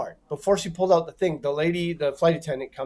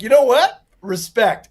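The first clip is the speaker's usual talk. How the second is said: impressed; 6 semitones higher, 6 dB louder than usual. Retold or imitated. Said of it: "I'm like".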